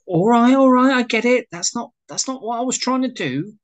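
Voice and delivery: high, loud protesting surrender; pleading to call off the telling-off; flustered backing down. Acted. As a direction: cut in high and defensive, easing off in volume. Wayne backs down from her rant.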